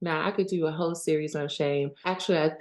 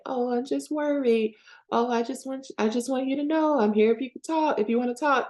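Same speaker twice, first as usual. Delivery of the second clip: higher, sing-song fake concern; manipulative worry; 7 semitones higher than usual. Pressing someone who said no to talk, manipulative worry. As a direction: plead it sweetly, pitched up, to get your way. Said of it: "be manipulative and say".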